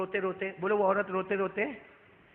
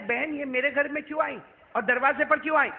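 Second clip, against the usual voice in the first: agitated questioning; 6 semitones higher, 5 dB louder than usual. Retold or imitated. Acts agitated questioning of weeping woman.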